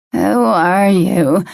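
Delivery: slow, slurred and vacant. A narrator's reading. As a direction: drag the words out, slurring. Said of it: "Once again, the words were slurred".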